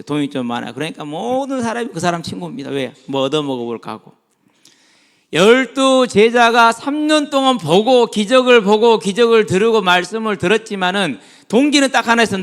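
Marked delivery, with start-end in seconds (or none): 5.3-12.4 forceful preaching. Real, not acted. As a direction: drive each phrase home to the congregation.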